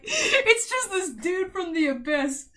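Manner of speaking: high, loud, slowed by real laughter; laughing. Real, not acted. Reader tickled by the partner's messages, talking through laughter. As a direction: struggle to talk through the giggles.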